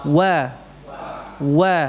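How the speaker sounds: ordinary talk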